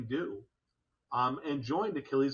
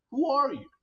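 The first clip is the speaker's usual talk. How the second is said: high, louder direct question; challenging the veteran; pointed question; 12 semitones higher, 6 dB louder than usual. Retold or imitated. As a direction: put the question straight to him.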